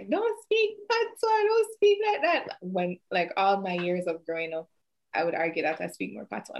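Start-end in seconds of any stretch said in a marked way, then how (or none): 0.1-2.6 high-pitched imitation of mom scolding; slow, high mock-scolding like a mother. Daughter imitating mom banning patois, high scolding voice. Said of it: "my mom used to be like".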